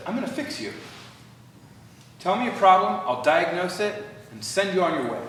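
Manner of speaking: loud, high, dismissive diagnostician; brusque fix-it tone. Shown as a demonstration. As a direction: rattle it off like a clinician in a hurry. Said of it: "Not with a tone that says".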